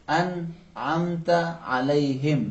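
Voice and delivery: slow, انعمت with a ain from the middle of the throat; slow teacher demo of the ain; throaty ain demo. Shown as a demonstration. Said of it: "حرف عین بھی اسی جگہ سے تلفظ ہوتا ہے".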